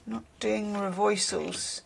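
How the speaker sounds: slow, soft mock New York accent; half-hearted, drawn-out New York accent; terrible New York accent. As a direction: try out a bad New York accent under your breath. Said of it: "That's my terrible New York accent".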